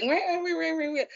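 mock nagging. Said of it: "she was like".

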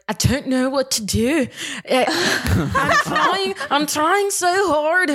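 high, hammed-up attempt at Welsh; bad Welsh accent. Imitating woman who woke up Welsh, bad Welsh accent. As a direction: put on a lilting, badly faked Welsh accent. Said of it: "with a Welsh accent".

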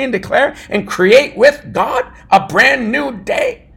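loud, rising preacher's cry; fiery preaching. Acted. Pastor preaching to his listeners, building to a shout. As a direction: preach it with full force to the congregation.

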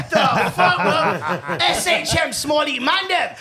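loud, high hyped-up shouting; big hype-man intro yell. Pretend.

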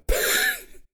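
a high escaping giggle; stifled chuckle. A narrator's reading. William failing to hold in a laugh, squeaky giggle. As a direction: let a tick of a laugh slip out. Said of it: "stifle the chuckle".

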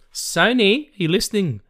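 high, loud shout to the studio; hammed-up call to Sony. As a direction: yell it up to the execs. Calling out to Sony.

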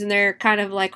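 plain reading voice

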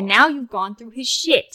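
angry accusation. Acted. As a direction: call out the snoop. NPC accuses player.

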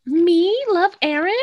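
high, drawn-out mock denial; flustered disbelief. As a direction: act caught out, stretching it slowly and high. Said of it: "her face is like".

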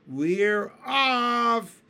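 loud, high chorus cry; excited cry. A narrator's reading. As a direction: cry out together. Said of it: "the others cried".

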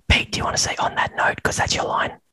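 unvoiced stage whisper. Real, not acted. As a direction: whisper a cue to your co-host. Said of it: "I don't have to whisper it".

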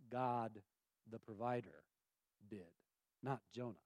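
soft, low and deliberate; a hushed reveal of who made it grow; hushed emphasis. Shown as a demonstration. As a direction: drop it low and quiet for weight.

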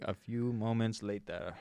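low-key narrator marking a time jump; SpongeBob edit. Retold or imitated. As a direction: draw it out like a time-card voiceover. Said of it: "like, SpongeBob edit".

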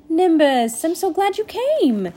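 high, loud, gushing welcome; delighted greeting. A narrator's reading. Rainy cuts Nimbus off to greet him, delighted. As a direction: burst in happily over the other speaker.

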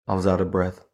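usual speaking voice

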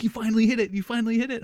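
high, loud gushing praise; a thrilled teacher cheering a student; proud teacher. Retold or imitated. Choir teacher praising her student's high note, high and gushing. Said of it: "She's like".

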